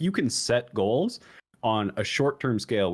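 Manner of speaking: ordinary talk, nothing marked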